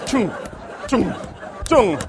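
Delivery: loud, punched rubber-stamp noise; imitated stamp thuds. Official stamping forged papers, loud imitated thuds. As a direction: thump out each stamp, spaced apart.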